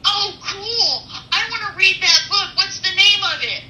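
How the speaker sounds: put-on high eager girl voice; bubbly teen who suddenly wants the book; excited teenage girl. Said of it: "And she goes".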